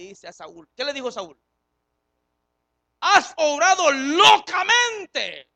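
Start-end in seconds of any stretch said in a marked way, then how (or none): 3.0-5.5 loud, high, slow rebuke; stern rebuke. Acted. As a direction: draw out each word as a stern prophet. Samuel rebukes Saúl.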